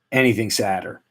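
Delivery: usual speaking voice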